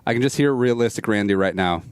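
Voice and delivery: usual speaking voice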